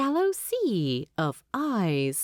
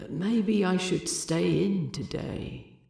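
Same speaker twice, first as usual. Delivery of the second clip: inner thought. Acted. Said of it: "Thorin thought".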